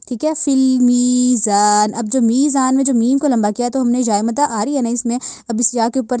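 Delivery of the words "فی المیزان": slow chanted فی المیزان; tajweed recitation. Shown as a demonstration. Teacher recites فی المیزان again as model.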